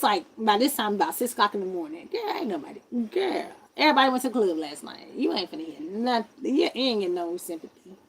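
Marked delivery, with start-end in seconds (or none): none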